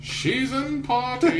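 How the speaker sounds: high, slow nasal croon. Retold or imitated. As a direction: stretch it out through the nose. Imitating the nasal singer.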